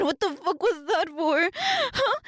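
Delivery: ordinary talk, nothing marked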